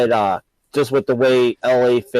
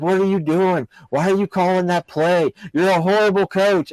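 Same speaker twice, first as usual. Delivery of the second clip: higher-pitched mock heckle, no real volume; half-yelled sports-fan complaint, pitched up; retold yelling; 6 semitones higher than usual. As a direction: imitate an outraged fan berating the coach. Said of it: "start yelling".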